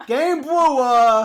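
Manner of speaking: drawn-out triumphant Game Brewer!; loud mock announcement. Shown as a demonstration. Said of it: "I almost said brewer".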